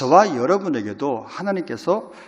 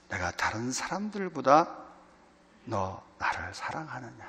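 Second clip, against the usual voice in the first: gentle question; 7 dB quieter than usual. Acted. Lord asks each listener gently.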